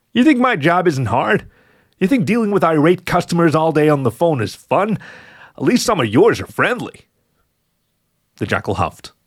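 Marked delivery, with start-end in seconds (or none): 0.1-7.0 higher, indignant protest; indignant growl. A narrator's reading. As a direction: snap back in offended disbelief. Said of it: "The fox growled".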